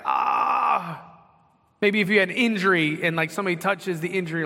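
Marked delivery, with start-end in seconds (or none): 0.0-1.0 short sharp yelp of pain, higher; a quick "ah" as if someone touched the sunburn. Retold or imitated. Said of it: "you're like".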